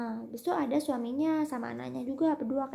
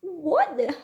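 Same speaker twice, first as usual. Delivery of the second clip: stunned reaction; 5 semitones higher, 7 dB louder than usual. Retold or imitated. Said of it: "Aku kayak".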